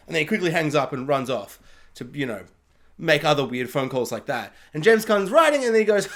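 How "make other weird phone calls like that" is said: normal talking voice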